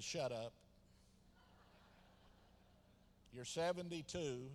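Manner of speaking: low, soft voice of God; understated retold rebuke. God answering the preacher's cry, quiet understated rebuke.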